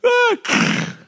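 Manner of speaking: very high gasp of delight; a tiny thrilled squeak; delighted squeal. Retold or imitated. Child riding a bike alone, very high squeak.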